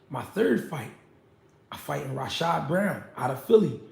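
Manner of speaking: ordinary delivery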